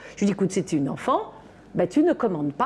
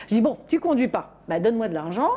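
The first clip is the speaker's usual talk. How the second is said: normal talking voice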